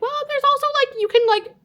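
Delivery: very high, hedging voice; timid objection. Retold or imitated. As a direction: squeak out a hesitant counterargument. Timidly arguing back with the leader.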